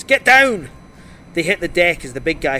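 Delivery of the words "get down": high, loud urgent yell; full-voiced alarm cry; shouted warning. A narrator's reading. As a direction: shout to make them drop. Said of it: "Sandy shouted to the officers".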